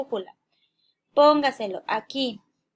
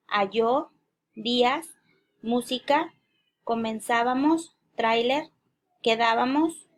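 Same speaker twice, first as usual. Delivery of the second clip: each word set apart with a rising end; list intonation. Real, not acted. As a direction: read out a word list, pausing after every item. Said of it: "las voy a decir en forma de lista". (entonación de lista, cada palabra sube al final)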